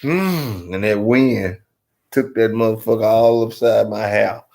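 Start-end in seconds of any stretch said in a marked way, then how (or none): 0.0-0.7 sharp high snort; nose blow snort. Shown as a demonstration. Retelling the failed snot blow, snort. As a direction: blow hard out one nostril. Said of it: "So I said".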